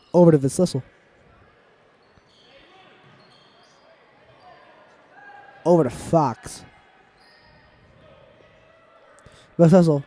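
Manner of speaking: lively live commentary, building excitement; play-by-play. Acted. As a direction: call the game like a sports announcer. Play-by-play resumes.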